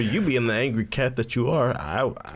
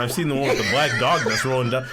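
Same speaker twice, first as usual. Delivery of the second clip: ordinary talk, nothing marked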